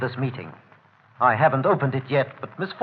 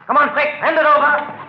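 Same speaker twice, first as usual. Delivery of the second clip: higher, louder snarl for the money; angry demand; 11 semitones higher, 5 dB louder than usual. Acted. Larrabee to Holmes, demanding the cash in a loud snarl. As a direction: grab at it, demanding loudly.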